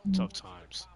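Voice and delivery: playful high mock sympathy. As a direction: say it in a silly mock-sad voice.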